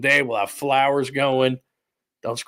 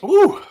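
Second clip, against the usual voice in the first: delighted high ooh; 7 dB louder than usual. Real, not acted. After first sip of cocktail.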